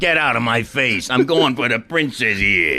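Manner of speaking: mock tough Brooklyn accent; lightly voiced gruff Brooklyn Mario; Brooklyn tough guy. Mario imagined as a Brooklyn tough guy. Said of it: "If he talked like".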